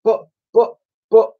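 high-pitched, stammered protest; small boy's desperate stammer; pleading. A narrator's reading. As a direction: plead like a frightened boy. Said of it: "pleaded Ned".

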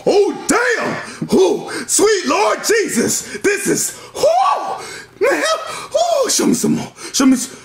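very high, slow, excited shouting; ecstatic shouts. Acted. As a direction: squeal with delight. Character ecstatic watching.

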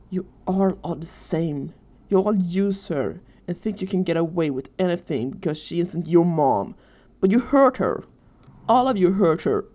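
building to loud, hurt outburst; anguished accusation. Acted. Tearful boy accuses Danny of hurting his mom, anguished outburst. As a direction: start heavy and accusing, end in a pained cry.

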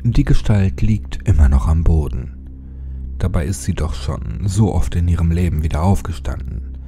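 plain reading voice

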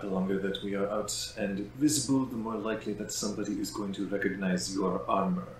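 Jakeda voice. Acted.

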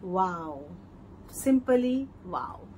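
slow, hushed mock amazement; softly drawn-out wow; imagined awestruck onlookers. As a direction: draw out each word as if stunned by admiration. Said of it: "लोग बोलेंगे".

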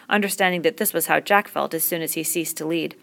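ordinary delivery, nothing marked

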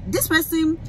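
ordinary delivery

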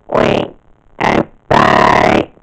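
loud, barked mechanical alert; robot alarm voice. Shown as a demonstration. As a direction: announce the invasion like a ring-modulated alien machine. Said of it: "use vocal technique".

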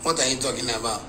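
lightly voiced old man, low and soft; a hint of an offended elder muttering; vexed old man. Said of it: "then he vex".

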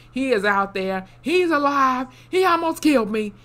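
rising to a high, loud mock-frantic cry; hammed-up alarm, climbing with each phrase; retold panic. Acting panicked survivor warning the crew.

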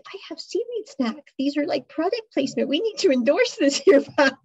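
mock eager pitch with a laugh; playful sales pitch. [name] pitches seaweed snacks to Pat, playfully eager. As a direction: sell the snack cheekily, laughing.

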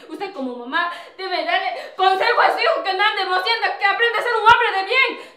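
rising, loud reproach; angry reproach. Acted. Nuera reprocha a la suegra. (reproche enojado)

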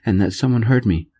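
ordinary delivery

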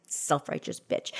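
under-the-breath whispered jab; whispered aside, low and soft; whispered insult. Acted. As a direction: hiss it under your breath.

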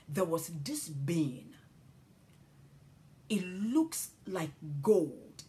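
normal talking voice

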